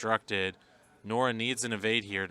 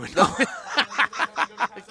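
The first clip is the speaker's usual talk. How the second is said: loud high laugh; 12 semitones higher, 8 dB louder than usual. Real, not acted. Laughing at own teasing.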